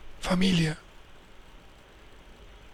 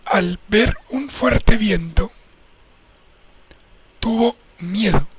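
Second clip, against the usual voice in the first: slow, drawn-out Bible narration; deliberate pulpit storytelling with long pauses; dramatic scripture reading; 4 dB louder, slower than usual. A narrator's reading. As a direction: stretch the phrases for suspense. Reads Bible passage aloud.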